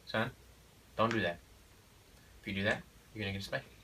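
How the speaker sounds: quiet, even and unhurried; calm normal voice. Shown as a demonstration. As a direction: say it calmly, no yelling. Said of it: "just like this".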